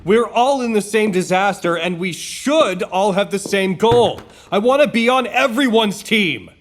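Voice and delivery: angry refusal. Acted. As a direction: plant your feet and refuse. Harley refuses to pick a side.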